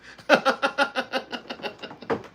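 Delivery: high airy wheeze of laughter. Real, not acted. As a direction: lets out a long high wheeze. Laughing at a viewer's comment.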